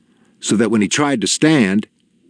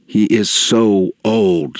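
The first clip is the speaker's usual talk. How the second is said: ordinary delivery, nothing marked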